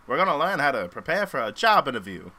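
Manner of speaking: a hint of an old-time narrator; light old newsreel narrator read; 1930s narrator voice. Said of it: "a nineteen thirties how, like, a narrator".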